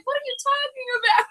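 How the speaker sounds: squeaky retold exasperation; imitated exasperated reply. Acting out the baffled reply.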